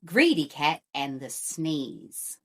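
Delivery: usual speaking voice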